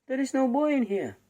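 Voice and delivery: giant's wife. Acted. The wife tells the giant no boy is here, high insistent voice. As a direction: cover for the boy in a high sing-song.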